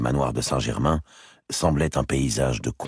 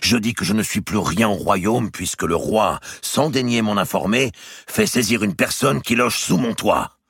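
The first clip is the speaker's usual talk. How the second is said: high, loud, wounded pride; indignant Robert; 8 semitones higher, 4 dB louder than usual. Acted. Robert protesting to his brother the king, indignant and loud. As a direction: storm in and throw it all back at the king.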